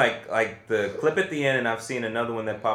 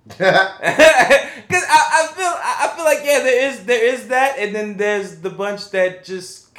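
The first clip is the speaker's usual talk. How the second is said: laughing; 12 semitones higher, 8 dB louder, much slower than usual. Real, not acted. Laughing while telling.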